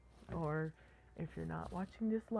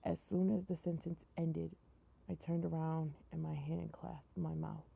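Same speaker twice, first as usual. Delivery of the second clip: ordinary delivery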